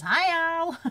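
high, loud mock-American greeting; bad American accent. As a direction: holler hello in a hammed-up American accent. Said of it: "I can't do an American accent".

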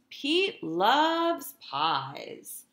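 ordinary delivery, nothing marked

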